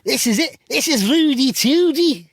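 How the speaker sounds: high, loud, drawn-out Scouse; hammed-up Scouse, pitched right up; Wade Rooney. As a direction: exaggerate a squeaky-high Scouse accent. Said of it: "I'll do Wade Rooney's bits".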